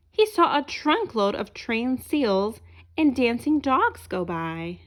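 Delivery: lively storybook narration; reading to children. A narrator's reading. Reading a picture book aloud to children, lively storyteller voice. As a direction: tell it brightly to young listeners.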